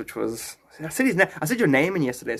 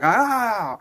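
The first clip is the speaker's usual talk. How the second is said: mock ah; 7 semitones higher, 4 dB louder than usual. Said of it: "I'm like".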